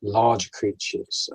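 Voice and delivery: normal talking voice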